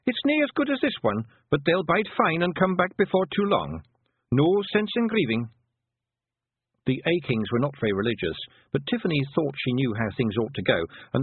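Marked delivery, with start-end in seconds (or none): 0.1-5.5 a wee Scots fighter shrugging off death; Scots pixie warrior. A narrator's reading. Rob Anybody shrugging off death to Tiffany, high Scots pixie voice.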